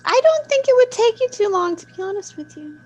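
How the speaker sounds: high, loud, pointed tease; high-pitched teasing. Acted. Woman needles Bull about how long he'd take, high and teasing.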